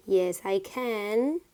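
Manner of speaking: said slowly, each word clear; teacher modelling it slowly; slow careful model. Shown as a demonstration. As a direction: spell it out slowly for the class. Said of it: "very important to pronunciate properly".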